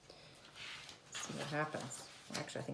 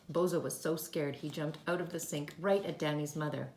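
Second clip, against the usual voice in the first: ordinary delivery, nothing marked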